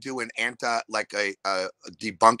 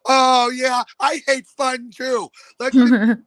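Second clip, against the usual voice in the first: much higher, louder, sing-song mock voice; mock cheerful sarcasm; 7 dB louder, much slower than usual. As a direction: sneer at the crowd in a high put-on voice. Mocking a joyless heckling crowd.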